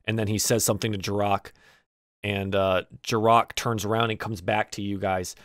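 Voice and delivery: normal talking voice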